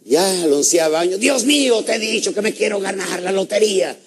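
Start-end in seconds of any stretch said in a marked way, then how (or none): 1.2-4.0 high-pitched, hammed-up wail to heaven; exasperated pleading. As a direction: cry out to God, fed up. Man pleading after eleven years.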